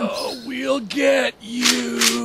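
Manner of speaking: slow, soft zombie moan; zombie groan. Acted. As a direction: drag it out like the undead. Zombie threatens.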